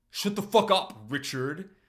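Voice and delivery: loud, high, laughing shout. Acted. Max laughing as he shouts at Richie, loud and high. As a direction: yell it through a laugh, half amused.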